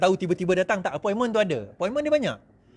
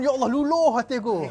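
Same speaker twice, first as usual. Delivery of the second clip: mock pious lament; 5 semitones higher than usual. Mocking pious candidate.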